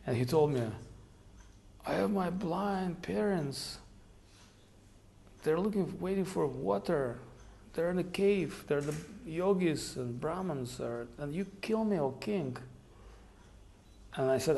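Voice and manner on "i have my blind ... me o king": put-on dying boy's plea. Dying young sadhu pleading with king.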